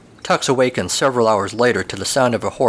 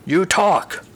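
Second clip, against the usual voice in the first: dark horse voice; 7 semitones higher than usual. Acted. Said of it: "the dark horse says".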